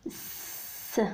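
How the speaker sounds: "س" as a bare voiceless hiss, no vowel; just hiss the "س" softly; a long unvoiced sss for "س"; hissed s sound. Shown as a demonstration. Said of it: "فإنه ينطق".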